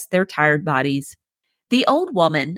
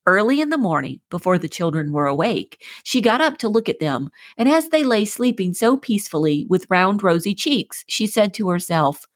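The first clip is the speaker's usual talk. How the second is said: ordinary delivery, nothing marked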